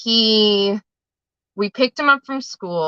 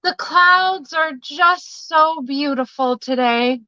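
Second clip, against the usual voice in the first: put-on slow, wistful boy's voice after a sigh; dreamy little boy; 6 semitones higher, slower than usual. Mom voicing her son explaining his tears to the teacher, slow and dreamy. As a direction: let it float out, awed and slow. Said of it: "he sighs and he says".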